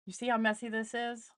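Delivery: ordinary delivery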